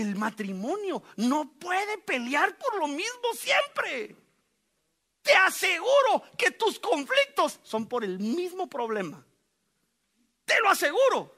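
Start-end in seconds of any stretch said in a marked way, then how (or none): 5.2-11.3 loud, high assurance; preaching shout. Real, not acted. Pastor assures the congregation with a loud shout. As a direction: shout it to convince them.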